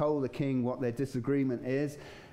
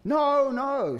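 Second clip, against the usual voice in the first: much higher, loud desperate denial; Peter's denial; 12 semitones higher, 7 dB louder than usual. Retold or imitated. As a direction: shout it out, frantic to deny. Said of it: "says Peter".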